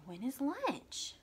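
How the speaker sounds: slow questioning read; asking voice. Shown as a demonstration. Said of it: "But it's asking".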